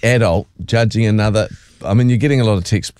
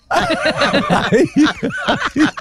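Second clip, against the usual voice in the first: laughs high and long; a shrieking laugh at the joke; high cackle of laughter. Real, not acted. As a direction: burst out cackling. Big laugh.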